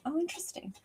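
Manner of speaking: hushed, breathy "oh, interesting" under the breath; whispered aside. Real, not acted. Medium reacting to a pet's message, whispered to herself. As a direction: murmur it to yourself, almost no voice.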